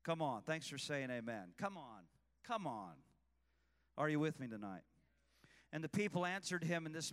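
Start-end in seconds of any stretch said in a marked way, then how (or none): none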